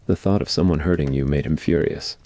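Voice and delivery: ordinary talk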